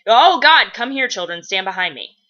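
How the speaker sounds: mild mock alarm, loud at the start then easing; a touch of urgent protectiveness; protective urgency. Balthazar sheltering children, lightly acted urgency. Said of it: "that's his first instinct".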